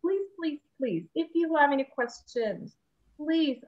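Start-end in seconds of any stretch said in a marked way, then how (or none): none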